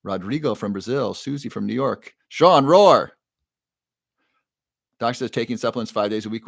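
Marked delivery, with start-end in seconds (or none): 2.3-3.1 loud, high called-out greeting; big playful shout to a fellow lion; playful roar. Pretend.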